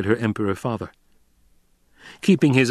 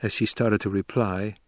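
ordinary delivery

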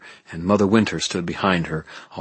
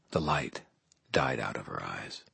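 usual speaking voice

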